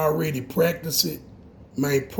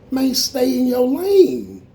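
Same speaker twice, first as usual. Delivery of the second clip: high, loud, drawn-out rebuke; exasperated telling-off; 9 semitones higher, 6 dB louder, slower than usual. Real, not acted. Fed-up man telling his critics to mind themselves, exasperated. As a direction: call it out slowly, high and loud.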